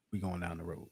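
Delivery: very soft, low acted reply; hushed answer. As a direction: drop right down in pitch and volume. Acted low answer in retold conversation.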